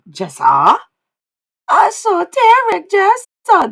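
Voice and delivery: loud, high, drawn-out mock call; mocking spirits. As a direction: tease by calling out the name slowly and loudly. Voices spirits mocking the client.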